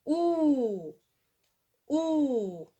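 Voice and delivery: "Ou" as one long held vowel; drawn-out ou. Shown as a demonstration. Teacher showing pupils how O-U sounds, long drawn-out ou. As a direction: stretch "Ou" into a long, falling oo. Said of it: "comment elle se prononce?".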